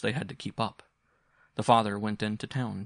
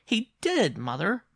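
put-on high, loud child's insistence; insistent boy; 13 semitones higher, 4 dB louder, slower than usual. A narrator's reading. Boy insists to doubting mother, childlike.

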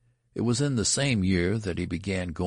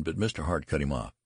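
ordinary delivery

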